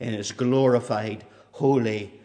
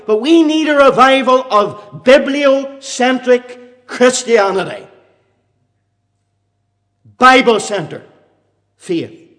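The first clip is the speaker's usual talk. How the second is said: loud fiery preaching; 12 semitones higher, 12 dB louder than usual. Acted. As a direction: thunder it from the pulpit. Preaching at full force.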